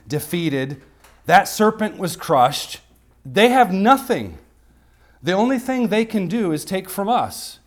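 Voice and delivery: rising pulpit fervor, driving each line home; preaching at full force. Acted. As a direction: build it up, pulpit fire. Preaching climax.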